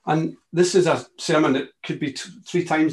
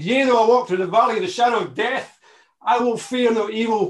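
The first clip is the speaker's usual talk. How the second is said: psalm recitation; 5 semitones higher, 4 dB louder than usual. Acted. Quotes Psalm twenty-three.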